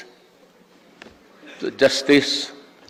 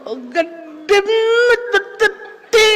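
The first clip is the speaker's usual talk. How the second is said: very high, loud shrill scolding shout; shrill angry professor; 3 dB louder, slower than usual. Retold or imitated. Professor scolding a student who stood up, shrill high shout. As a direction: screech at the student like an irate old teacher. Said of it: "boses, ano, siya, shrill".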